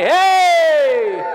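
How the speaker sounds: loud high cry of joy; shout. Real, not acted. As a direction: shout it out to the congregation. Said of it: "Everyone say".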